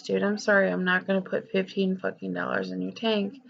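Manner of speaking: ordinary talk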